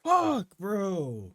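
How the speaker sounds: sudden high loud yelp; shocked outburst. Real, not acted. Viewer jolted by a twist on screen, shocked yelp. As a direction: blurt it out in disbelief.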